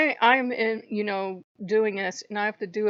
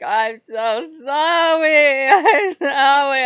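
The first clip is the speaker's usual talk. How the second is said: high, breaking sobbing plea, much louder at the end; crying apology; 6 semitones higher, 13 dB louder, much slower than usual. Retold or imitated. Loki begging her forgiveness, sobbing. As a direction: sob out the apology like a guilty pet begging.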